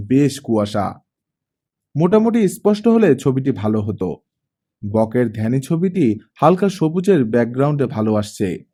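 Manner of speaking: ordinary delivery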